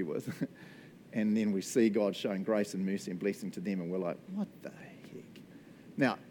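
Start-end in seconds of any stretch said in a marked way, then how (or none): 4.3-4.9 mock-incredulous, much softer aside; hushed disbelief. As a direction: say it under your breath, baffled. Muttered disbelief at God's grace.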